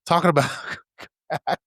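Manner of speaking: breaking into real laughter mid-phrase; helpless giggles swallowing the words; cracking up. Real, not acted. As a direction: lose it laughing as you try to finish. Cracking up while retelling the interview.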